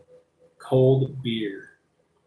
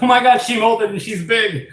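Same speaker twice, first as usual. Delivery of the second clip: excited; 9 semitones higher, 4 dB louder than usual. Retold or imitated. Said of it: "I was like".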